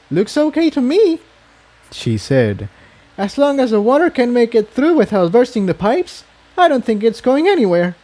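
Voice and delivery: high, light upbeat pony voice; easygoing, chirpy inspection verdict; young mare Tiptoe. A narrator's reading. Tiptoe reports on the pipes to Jigsaw, high and light.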